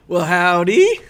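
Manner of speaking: a hammed-up Texas cowboy greeting; Texas accent. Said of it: "I don't have the Texas accent down".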